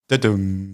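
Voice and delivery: low, dry ta-dum like a sitcom beat; mock drum sting. Closing his embarrassing story for friends with a low mock drum sting. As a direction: punctuate the joke with a sound effect.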